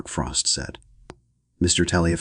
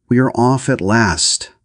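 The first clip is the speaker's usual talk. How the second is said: slow, louder, higher ceremonial intoning; 6 semitones higher, 6 dB louder, slower than usual. A narrator's reading. Hostess to her guests, slow solemn intoning. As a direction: announce it slowly like a proclamation. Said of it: "Missus Morier intoned".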